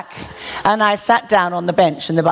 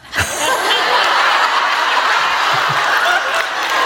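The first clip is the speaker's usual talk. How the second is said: long breathy laugh. Real, not acted. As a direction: let the laugh run on. Laughs at God's reply.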